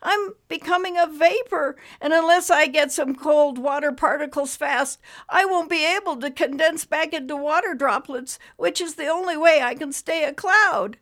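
high, loud cloud creature, worried; a worried little cloud explaining his fading; high cloud voice, anxious and urgent. Acted.

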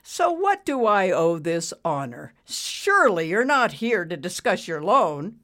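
a touch of mock politeness; arrogant boss, condescending. A narrator's reading. Boss greeting a borrower, condescending. Ricky's character voice.

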